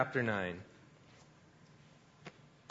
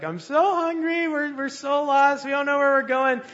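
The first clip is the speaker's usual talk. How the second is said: mock tearful wife; 11 semitones higher, 5 dB louder than usual. Husband imitating his tearful wife lost in Ireland, whiny. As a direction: imitate a hungry lost wife near tears. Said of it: "my wife's in tears".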